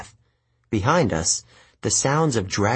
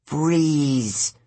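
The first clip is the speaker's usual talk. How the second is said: drawn-out, higher warning; 4 semitones higher than usual. A narrator's reading. Grover warning Tyson in the dark prison, drawn-out warning. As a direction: warn him, stretching the word. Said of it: "Grover warned".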